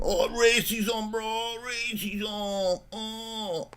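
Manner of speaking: put-on high, slow, soft Mexican-accented wail; mock victim whine. Host imitating the caller playing victim, mock whining Mexican accent. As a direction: drag it out as a mocking high lament, Mexican accent. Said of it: "then you play the victim".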